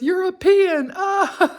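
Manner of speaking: bursting into laughter, loud and high; delivering the punchline through real laughter; laughing punchline. Real, not acted. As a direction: crack up at your own punchline.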